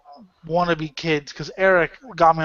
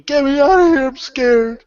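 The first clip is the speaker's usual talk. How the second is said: higher and louder, mock panicked yell; retold panicked scream; 9 semitones higher, 6 dB louder than usual. Poser kid panicking in Compton, hammed-up mock scream. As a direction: imitate a scared kid begging to leave. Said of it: "before he started screaming".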